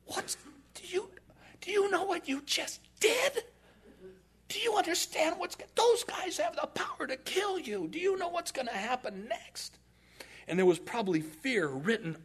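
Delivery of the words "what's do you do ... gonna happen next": high, breathless frightened rush; panicked disciples. Retold or imitated. Disciples panicking to Jesus after the showdown, high and frightened. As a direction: gasp it out like scared followers.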